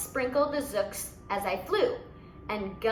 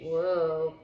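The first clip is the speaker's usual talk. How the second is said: long low astonished whoa; 7 semitones lower than usual. Real, not acted. Reader to listener, a low astonished whoa. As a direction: gasp at the bomb. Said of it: "reader reacts in own voice".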